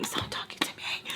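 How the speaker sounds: exasperated whisper, nearly voiceless; whispered plea. Acted. Vlogger wishing the woman would stop asking her, whispered exasperation. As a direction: hiss it privately under the breath.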